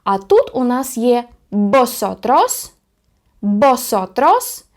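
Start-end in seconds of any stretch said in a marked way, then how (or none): 1.5-4.6 slow, drawn-out Spanish with rising end; teacher's deliberate Spanish word; slow model pronunciation. Shown as a demonstration. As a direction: sound it out for learners, ending upward.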